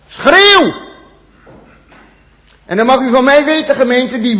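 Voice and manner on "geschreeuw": the word geschreeuw cried out high and loud; mock outcry. Preacher crying out the Hebrew outcry word, high and loud. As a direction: cry the word out like a scream.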